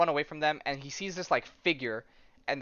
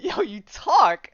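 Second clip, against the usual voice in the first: slow, high, loud disbelief; hammed-up amazed question; astonished; 8 semitones higher, 10 dB louder, much slower than usual. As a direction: stretch it out, stunned that it talks. Man astonished that dog talks.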